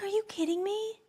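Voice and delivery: high and soft are you kidding me; quiet high astonishment; disbelieving squeak. Retold or imitated. Retelling disbelief.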